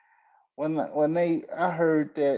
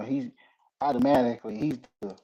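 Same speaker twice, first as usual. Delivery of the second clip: usual speaking voice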